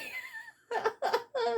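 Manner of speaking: real burst of high, squealing laughter; high-pitched laugh. Real, not acted. Reader amused by the bush prankster, laughing hard. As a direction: crack up in a high cackle.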